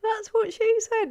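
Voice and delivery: high squeak straight out of laughter; giggling punchline. Real, not acted. Streamer joking about the big super chat, giggling. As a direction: squeeze the joke out through giggles. Cracks up at own innuendo.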